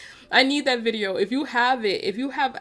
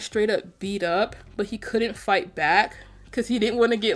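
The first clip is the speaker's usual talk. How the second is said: ordinary talk, nothing marked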